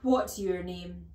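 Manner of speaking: loud, slow demand; stern, drawn-out interrogation; barked question. A narrator's reading. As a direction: bark it at a small boy. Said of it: "she barked".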